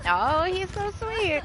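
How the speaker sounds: cooing. Real, not acted. Killer spares her, cooing.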